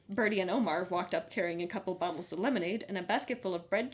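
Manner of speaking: usual speaking voice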